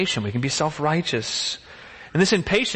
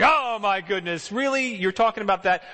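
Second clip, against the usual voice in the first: high, exasperated "oh my goodness, really"; mock fed-up disbelief; eye-rolling exasperation; 6 semitones higher than usual. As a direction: roll your eyes at petty disciples. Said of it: "I'd be rolling my eyes".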